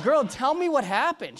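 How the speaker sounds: high, loud, stretched-out urging; retold excitement, drawing the words out; eager demand. Preacher demanding the healed woman's story, eager.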